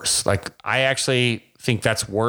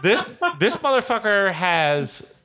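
higher, slow and laughing through it; laughing kids; 9 semitones higher, slower than usual. Retold or imitated. Coach voices kids hearing Pete Rose, laughing. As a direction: imitate stunned kids while cracking up. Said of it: "these kids are sitting here going".

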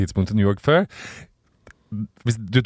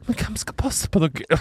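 worried parents; 9 semitones higher, 4 dB quieter, slower than usual. Retold or imitated. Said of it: "Og de bare".

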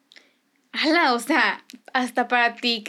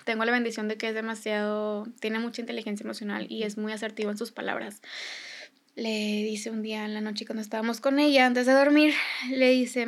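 ordinary delivery

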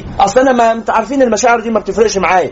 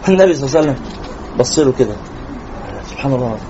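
hushed awe; 8 semitones lower, 5 dB quieter, faster than usual. Real, not acted. Awed hushed aside.

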